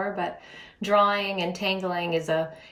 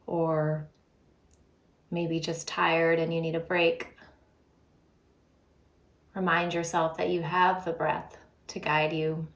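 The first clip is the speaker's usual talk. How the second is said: guided breathing meditation. Real, not acted. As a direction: lead them unhurriedly through the breath, leaving space between phrases.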